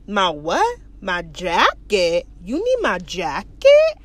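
drawn-out, incredulous questioning; baffled. Retold or imitated. Man questions guard's demand for his jacket, baffled. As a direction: act out a man who can't believe it.

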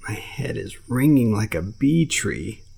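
put-on a grumpy child complaining slowly; sullen little voice. Mowgli sulks down at the bear and panther. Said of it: "said a sullen little voice".

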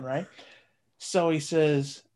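ordinary talk

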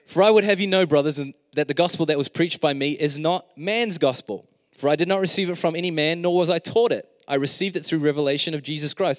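loud, high scripture reading; fervent preaching. Real, not acted. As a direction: preach the verses with full force. Reading scripture at full force.

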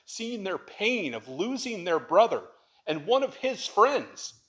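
from a slow, steady start to a full preaching peak; preaching crescendo. Acted. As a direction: build each title slowly to a climax. Preaching builds to climax.

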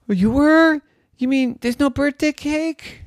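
high, loud stunned cry; shocked the cake is gone; dismayed surprise. Acted. Girl dismayed about cake.

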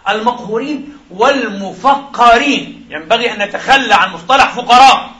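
normal talking voice

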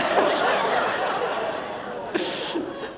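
breathless laughter; real unvoiced laugh. Real, not acted. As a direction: laugh helplessly at his own joke. Laughs at his own joke.

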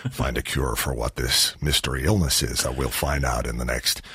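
very low, slow American doctor; Hugh Laurie in House. Retold or imitated. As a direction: drop deep and soft, mock American accent, drawing it out. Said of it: "just talk really slowly like this".

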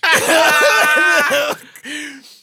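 loud, high burst of laughter; big laugh. Real, not acted. Laughing at the Spanish-girls joke, loud burst. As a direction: crack up out loud.

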